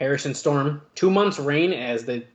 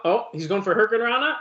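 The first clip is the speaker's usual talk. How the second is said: usual speaking voice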